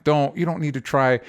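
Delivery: ordinary talk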